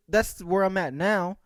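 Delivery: ordinary delivery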